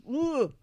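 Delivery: high, loud "ooh" of alarm; imitated startled "ooh". Friend acting out a knee-jerk fear response, mock-startled ooh. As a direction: let out a sudden mock-alarmed whoop.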